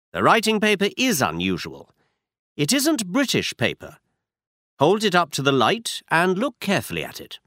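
brisk, sure Holmes explaining a clue; Holmes, lightly voiced.

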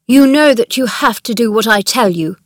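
loud, hard and commanding. A narrator's reading. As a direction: lay down the law to a servant. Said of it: "in a hard voice".

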